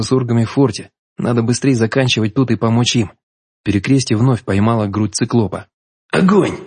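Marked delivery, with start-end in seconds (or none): 6.1-6.6 higher, barked order to fire; sharp command. A narrator's reading. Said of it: "скомандовал я".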